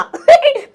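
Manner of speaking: quick high burst of laughter; sudden high laugh. Real, not acted. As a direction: laugh out at your own joke. Laughs at his own slang.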